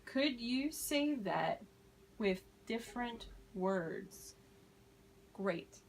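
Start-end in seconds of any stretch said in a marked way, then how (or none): 0.0-4.4 slow, clear teacher model; slow model phrase. Shown as a demonstration. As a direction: say it slowly for learners. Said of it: "Someone needs to use different words".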